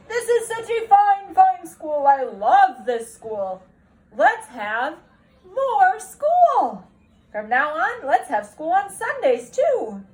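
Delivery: an ecstatic principal announcing to the whole school; booming principal. Acted. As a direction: proclaim it like an overjoyed headmaster.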